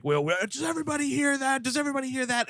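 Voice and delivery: high, rushed imitation of alarm; mock panic. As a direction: call out urgently, hamming up the panic. Acted panicked question from film.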